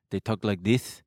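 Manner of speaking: lisping Israeli accent; a light Israeli lisp on every s. Shown as a demonstration. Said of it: "the most Israeli accents have a lisp".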